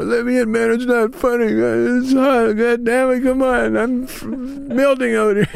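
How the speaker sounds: very high frantic mock yell; going nuts. Cheech bangs on the door in the heat, imitated going nuts. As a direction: act out a furious plea to get in. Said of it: "He went nuts, man. He's banging on the door".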